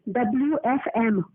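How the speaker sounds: slow, spelled-out डब्ल्यूएफएम, each letter separate; correcting. Real, not acted. Correcting the agent's misheard letters.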